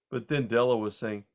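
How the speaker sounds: ordinary delivery, nothing marked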